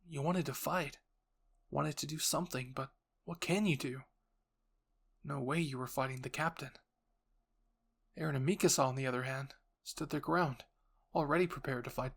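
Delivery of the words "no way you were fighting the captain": ordinary delivery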